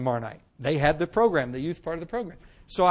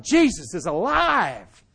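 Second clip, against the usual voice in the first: slow, drawn-out proclaiming call; slow retold proclamation; retold shout; much slower than usual. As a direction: announce it like big news. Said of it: "I shouted".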